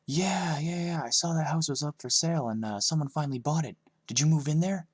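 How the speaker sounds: high, loud, excited gossip; eager kid Evan. A narrator's reading. Classmate Evan pestering the new kid, high and loud. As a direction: blurt it out like a nosy classmate.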